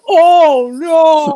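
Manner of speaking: high, loud "oh no" cry; dismayed shout. Retold or imitated. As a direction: cry out in horror. Retold reaction to Soul Stone.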